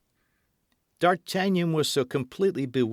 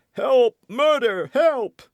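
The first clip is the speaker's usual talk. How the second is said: shrieking for help, much higher and louder; terrified cry for help; 9 dB louder, slower than usual. A narrator's reading. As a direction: scream it as if facing an assassin. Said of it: "cried he".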